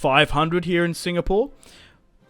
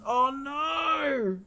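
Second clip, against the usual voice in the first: dismayed cry; 10 semitones higher, 6 dB quieter than usual. Real, not acted. Front line collapses.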